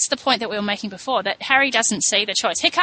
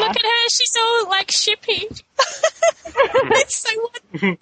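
gushing teasing; 12 semitones higher than usual. Real, not acted. Teasing friend for being shippy.

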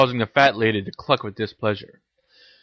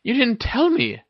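high-pitched reproach; scolding; 13 semitones higher than usual. A narrator's reading. Hermione reproaches Harry, scolding. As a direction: scold a friend for keeping secrets. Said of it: "scolded Hermione".

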